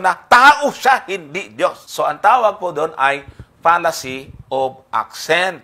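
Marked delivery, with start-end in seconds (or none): 0.0-1.1 TAO hit high, loud and stretched; stress on TAO. Shown as a demonstration. Voicing what others want it to mean, TAO overstressed. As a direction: punch "tao" high and long. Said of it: "parang gusto yung palabasin".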